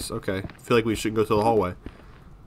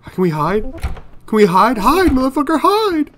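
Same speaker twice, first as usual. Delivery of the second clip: loud, high, frantic shouting; panicked yelling; 7 dB louder than usual. Real, not acted. Player yelling at the game to hide, panicked. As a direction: build from urgent question to a high yell.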